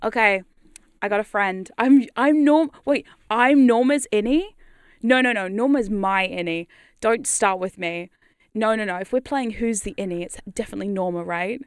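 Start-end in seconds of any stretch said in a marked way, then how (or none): 1.8-6.7 mock indignation. Streamer disputes being called Norma's innie, mock indignant. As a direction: protest in mock outrage, leaning on key words.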